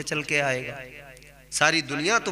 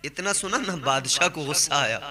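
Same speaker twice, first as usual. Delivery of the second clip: ordinary talk, nothing marked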